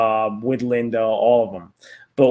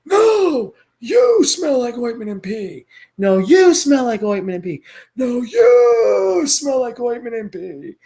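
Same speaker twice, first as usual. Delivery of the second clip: put-on high, thin old man voice shouting back; high old man yelling; 12 semitones higher, 6 dB louder than usual. Old husband shouting back at Louise, high cranky voice. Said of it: "He's like".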